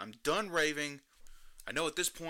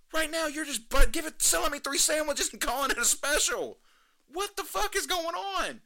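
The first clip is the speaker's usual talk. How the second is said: hammed-up, high-pitched fan voice; mocking excited customers; 12 semitones higher, 5 dB louder than usual. Host mocking hyped customers who love the menu hack, high hammed-up fan voice. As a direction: parody the hyped-up customers, squealing and overexcited. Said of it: "They're like".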